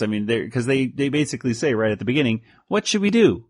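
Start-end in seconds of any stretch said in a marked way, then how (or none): 2.7-3.5 high, bouncy cartoon kid. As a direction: pitch up like a cartoon. Warners voiced.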